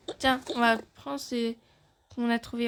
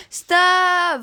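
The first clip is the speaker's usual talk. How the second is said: loud high shout of stop; 7 semitones higher, 11 dB louder than usual. Acted. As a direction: bellow to break up the fight. Parent stops sibling fight.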